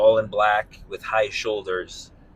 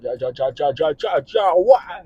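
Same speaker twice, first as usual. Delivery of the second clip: stuttered syllables climbing higher; stammering Jimmy Stewart; 6 semitones higher than usual. Retold or imitated. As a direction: sputter like the flustered old star. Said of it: "a Jimmy Stewart impression".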